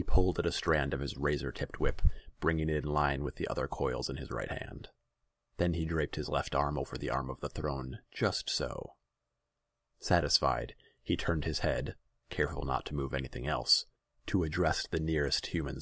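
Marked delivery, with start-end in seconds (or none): none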